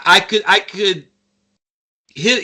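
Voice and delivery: normal talking voice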